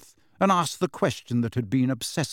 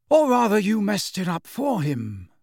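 higher, pleased correction; satisfied smile; 7 semitones higher, 3 dB louder than usual. A narrator's reading. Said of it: "with a satisfied smile".

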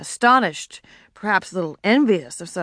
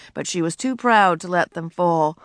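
ordinary delivery

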